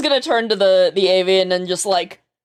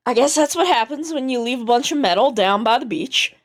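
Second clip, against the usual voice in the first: put-on Southern accent. Remarking on metal left by the beach, Southern accent. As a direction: lean into the Southern lilt. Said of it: "I don't know why she's becoming Southern".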